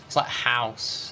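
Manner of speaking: usual speaking voice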